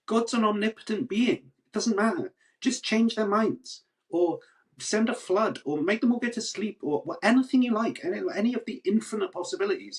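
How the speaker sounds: high-pitched exasperated listing. Real, not acted. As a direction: rattle off the options in disbelief. Exasperated rant about God's options.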